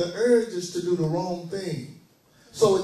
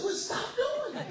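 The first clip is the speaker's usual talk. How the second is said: put-on much higher, slow, cheeky little-boy voice; mock kid comeback; 7 dB quieter than usual. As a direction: squeak it out like a sassy kid, laughing. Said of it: "I said".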